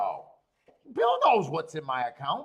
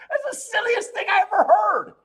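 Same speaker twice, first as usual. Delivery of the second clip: high, loud, laughing and almost crying; can barely get it out for laughing; laughing disbelief; 14 semitones higher, 9 dB louder than usual. Real, not acted. Mocking his friend's betting logic, laughing high and loud.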